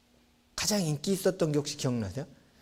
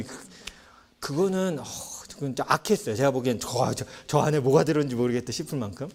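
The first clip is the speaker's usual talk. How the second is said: ordinary talk, nothing marked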